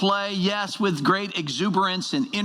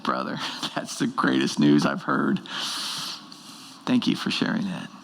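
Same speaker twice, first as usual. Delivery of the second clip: moved reply; 13 semitones lower than usual. Retold or imitated. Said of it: "It's like".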